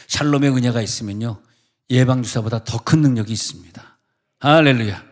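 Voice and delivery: loud, rising preacher's cry; fervent preaching. Acted. Preacher exhorting the congregation, loud and fervent. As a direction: build to a hallelujah.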